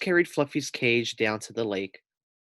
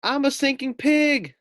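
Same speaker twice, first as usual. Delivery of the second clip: much higher, louder panic; panicked pig; 5 dB louder than usual. A narrator's reading. Pig sinking in the lake, high panicked wail. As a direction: wail it as you sink.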